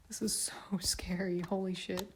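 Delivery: whispered "This is so scary", slow and breathy with nerves; scared whisper. Real, not acted. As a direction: whisper it as if afraid to jinx it. Nervous while moving the camera.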